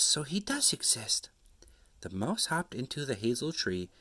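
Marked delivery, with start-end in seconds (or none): 0.0-1.3 whispered in wonder; hushed amazed child; awed whisper. Acted. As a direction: breathe it like a revelation.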